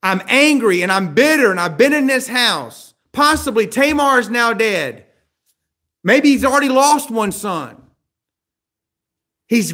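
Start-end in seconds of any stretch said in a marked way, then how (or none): 0.0-7.8 loud, high, bitter rant; angry bitter outburst. Acted. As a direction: pour out the grievance in anger.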